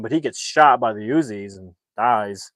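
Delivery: ordinary talk, nothing marked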